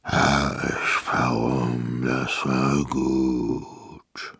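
low, very drawn-out groan of satisfaction; contented full giant. A narrator's reading. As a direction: savour it slowly, like rubbing a full belly. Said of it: "über den Bauch und machte".

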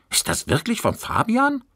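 high, incredulous question; can't believe it, call it out; disbelieving call. A narrator's reading. Classmate doubting the essay, high incredulous call. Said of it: "rief ungläubig".

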